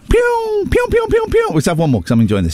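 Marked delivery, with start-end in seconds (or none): 0.1-1.5 very high shouted laser pew; toy gun sound. Retold or imitated. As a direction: fire a toy blaster with your voice. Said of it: "that's a gun".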